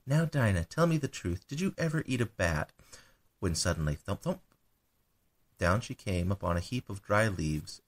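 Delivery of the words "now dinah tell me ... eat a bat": higher, earnest questioning of the cat; earnestly asking the cat, pitched higher. Acted. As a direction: press her gently for the truth. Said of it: "saying to her very earnestly".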